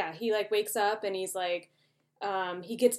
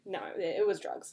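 ordinary talk, nothing marked

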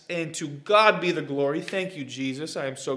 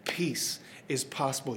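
preaching; 3 semitones lower, 7 dB quieter than usual. Acted. Preaching repeat.

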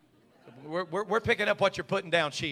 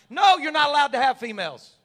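mock loud retort; 7 semitones higher, 11 dB louder than usual. Mock reply in sermon.

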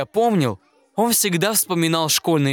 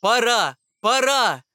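higher, ringing call to the whole forest; announcing; 5 semitones higher than usual. A narrator's reading. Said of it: "объявляет всему лесу клён". (громко объявляет, голос выше)

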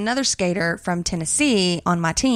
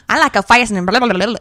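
slurred Southern gibberish, higher and louder; Boomhauer-like babble; 3 semitones higher, 5 dB louder, faster than usual. Retold or imitated. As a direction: babble unintelligibly in a thick Southern twang. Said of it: "She was like Boomhauer, but like a girl".

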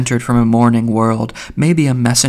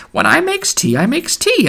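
put-on very high-pitched old woman's wheedle; wheedling old woman. A narrator's reading. Mulligan mimicking an old tea-maker, wheedling old woman's voice. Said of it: "in an old woman's wheedling voice".

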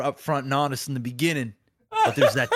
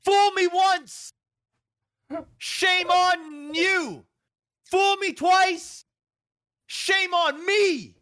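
mock jilted lover; 12 semitones higher than usual. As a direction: drag out the heartbroken lines, pitched way up. Scorned lover telling off Cyberpunk.

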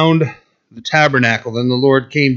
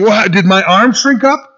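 mocking, incredulous, a bit higher; sarcastic God; 6 semitones higher, 3 dB louder than usual. Preacher voicing God's sarcasm to doubting Israel, mock incredulous. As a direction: needle them like God would. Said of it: "This is literally sarcasm".

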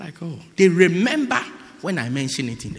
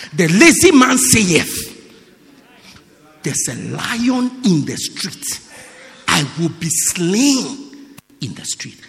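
slow, measured scripture reading; slower than usual. Real, not acted. Preacher reads Proverbs to the church, slow solemn reading. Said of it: "reading Proverbs aloud".

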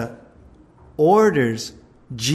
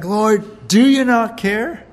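higher and louder, whiny protest; pitched-up indignant cry; mock exasperated complaint; 7 semitones higher, 5 dB louder, slower than usual. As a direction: play Martha storming in to complain. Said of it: "She's like".

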